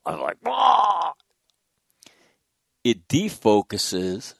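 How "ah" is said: sudden high yell of delight; a short high cry of discovery; excited yell. Retold or imitated. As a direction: yelp out loud with excitement. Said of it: "that's when I yell".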